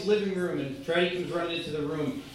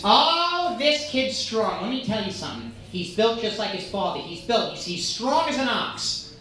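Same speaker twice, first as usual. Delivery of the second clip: Queens mom bragging; 3 semitones higher than usual. Retold or imitated. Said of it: "this lady from Queens".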